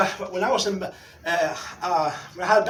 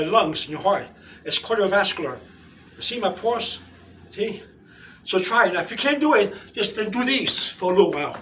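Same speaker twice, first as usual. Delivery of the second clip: ordinary delivery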